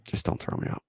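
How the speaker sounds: whispered, begging not to be kicked out; a hushed, hammed-up plea; mock whisper plea. To his wife after losing money, a whispered mock plea.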